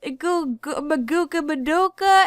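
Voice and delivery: halting, high-pitched mimicry; imitated girl stumbling on words. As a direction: stammer through it in a put-on high voice.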